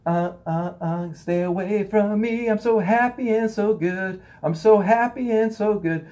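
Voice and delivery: high, slow, prissy mock voice backing away; mock fake happiness. Shunning a suffering person, high mock fake-happy voice. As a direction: ham up someone pretending to be happy and good. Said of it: "pretending that we're happy".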